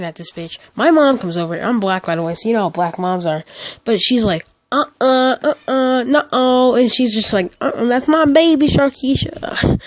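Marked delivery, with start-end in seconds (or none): 4.7-6.7 higher, hammed-up disapproving mother. 7.6-9.8 high-pitched caricature of a protective mother. Retold or imitated.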